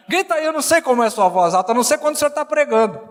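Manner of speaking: quick, insistent mock goading; imitated pestering son. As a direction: egg dad on in a fast, pushy rush. Voicing son egging him on.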